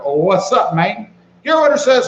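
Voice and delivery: usual speaking voice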